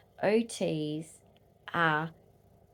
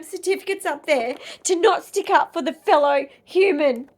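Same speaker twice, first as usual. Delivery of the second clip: shouting, pitch climbing with rage; furious rant; 8 semitones higher, 9 dB louder than usual. Real, not acted. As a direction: yell it at the government, fists clenched. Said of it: "for how angry I am".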